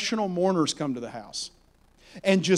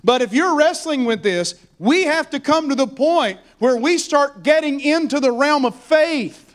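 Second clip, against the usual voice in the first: raised, driving preacher's voice building in pitch; impassioned preaching; 7 semitones higher, 3 dB louder than usual. Real, not acted. Preacher urging the congregation into faith, impassioned preaching. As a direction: preach it with relentless rising urgency.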